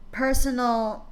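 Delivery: usual speaking voice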